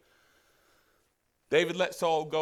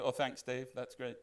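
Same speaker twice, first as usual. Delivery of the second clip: low, soft deadpan aside; dry mock thanks; 6 semitones lower, 8 dB quieter than usual. Saul answering David's news about killing him, dryly sarcastic. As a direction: drop the voice for an underwhelmed thank-you. Voices Saul's imagined dry reply.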